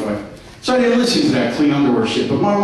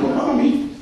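put-on higher, hurt little-boy reply; lightly voiced son, higher and meek; mock meek son; 7 semitones higher than usual. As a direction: answer mom like a wounded kid. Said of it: "I'd be like".

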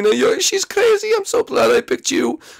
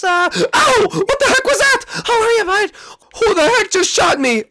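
shocked yelp; 10 semitones higher than usual. Acted. Mario suddenly shot.

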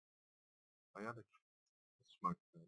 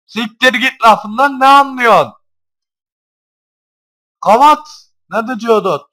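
screaming rage; 12 semitones higher, 16 dB louder than usual. Real, not acted. Gamer screaming at a Zubat that won't leave, enraged. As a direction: scream every word.

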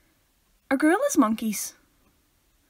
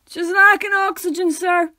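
louder, insistent mock student reply; acted excuse to teacher; 4 semitones higher, 6 dB louder than usual. Student assuring the teacher they're fine, loud mock earnestness. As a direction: play it loud and over-earnest, last words stretched.

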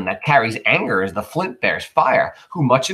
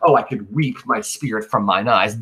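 ordinary talk, nothing marked

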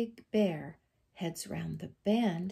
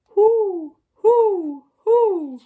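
loud, high, drawn-out owl hoots; owl hooting; 12 semitones higher, 15 dB louder, much slower than usual. A narrator's reading. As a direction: hoot three times like an owl. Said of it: "an owl says".

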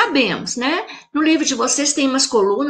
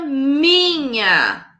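"minha" stretched slowly, weight on "mi"; strong first syllable. Shown as a demonstration. Teacher showing pupils the strong syllable, "minha" drawn out on "mi". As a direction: lean long on the "mi" of "minha". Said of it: "Qual sílaba que foi a mais forte".

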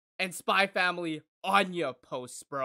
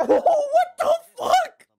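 shocked shout; 10 dB louder, much slower than usual. Real, not acted. Shocked reaction to scene.

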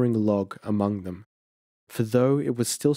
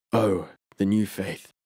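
a touch of scorn in a lowered voice; contemptuous. A narrator's reading. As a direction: dismiss it lightly, with mild disdain. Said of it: "rather contemptuously".

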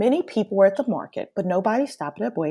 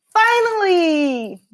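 high, loud, slow cry of relief; a boy shouting at last; relieved boy; 11 semitones higher, 4 dB louder, much slower than usual. Acted. Pablo finally off to market, loud joyful cry.

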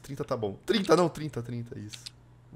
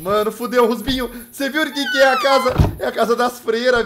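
scared horror game streamer; 6 semitones higher, 8 dB louder than usual. Real, not acted. Panics on finding the nuns' house.

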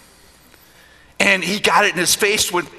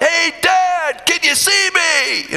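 retold yell; 11 semitones higher, much slower than usual. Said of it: "he yelled out".

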